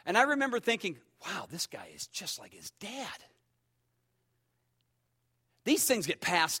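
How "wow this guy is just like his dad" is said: inner thought. Retold or imitated.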